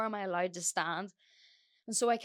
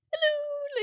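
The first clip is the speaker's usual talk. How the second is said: squealed much higher and louder hello; excited hello; 6 dB louder than usual. Retold or imitated. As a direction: greet him like an overjoyed fan. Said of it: "I'm so excited to be there".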